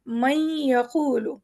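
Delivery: مَن یَقُولُ drawn out, noon and ya as one nasal sound; teacher models the new merged sound; slow merged sound. Shown as a demonstration. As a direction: slowly blend the noon into ya. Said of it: "ایک نئی sound ہم نے پروناؤنس کی".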